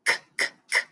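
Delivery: voiceless "k" clicks, one by one; K letter sound. Shown as a demonstration. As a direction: kick out each "k" with no vowel. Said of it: "Can you kick for me?".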